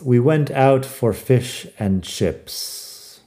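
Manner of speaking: slow, careful model sentence; deliberately slow teacher read; slow example sentence. Shown as a demonstration. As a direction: say it slowly, word by word, for learners. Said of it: "Así tengo un par de frases".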